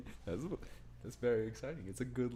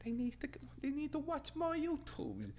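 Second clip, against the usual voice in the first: silly mocking; 13 semitones higher than usual. Mock silly "YouTubes" bit.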